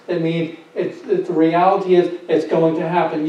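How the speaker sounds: ordinary talk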